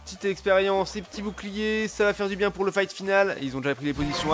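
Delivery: ordinary talk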